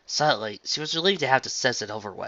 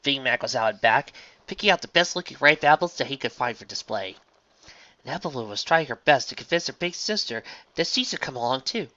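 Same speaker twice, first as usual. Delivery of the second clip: ordinary delivery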